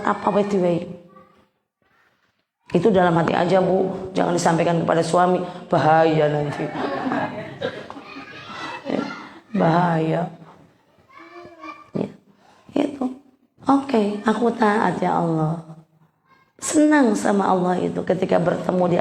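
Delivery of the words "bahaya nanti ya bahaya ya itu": low, chuckling warning; laughing warning. Real, not acted. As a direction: laugh it off as a joke. (peringatan sambil tertawa)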